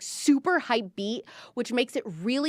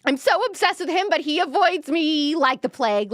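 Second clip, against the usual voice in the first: higher, louder mock cheer; fake cheerful; 6 semitones higher, 4 dB louder than usual. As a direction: chirp it with a fake smile. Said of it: "so fake like that".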